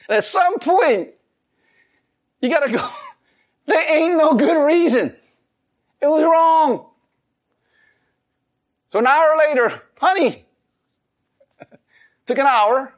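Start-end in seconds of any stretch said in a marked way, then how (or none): 3.7-6.9 mock wail. Admitting fault, mock wail. 10.0-10.4 sweet honey. Retold or imitated. Approaching wife to apologise.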